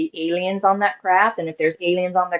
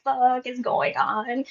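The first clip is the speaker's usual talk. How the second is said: slow, softer stunned aside, pitched up; hushed alarm; 6 semitones higher, 8 dB quieter, much slower than usual. Retold or imitated. Child hearing mother scream, hushed alarm. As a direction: draw it out under your breath, bewildered.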